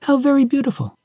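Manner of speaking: high, admiring exclamation; exclaiming. A narrator's reading. Pandora marvels at Hope's beautiful wings, exclaiming. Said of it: "exclaimed Pandora".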